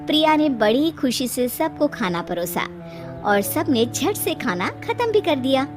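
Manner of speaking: plain reading voice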